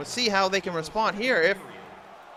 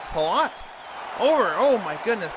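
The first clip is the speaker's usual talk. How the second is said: excited call; 2 semitones higher than usual. Real, not acted. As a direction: jump on the chance. Big chance during play-by-play.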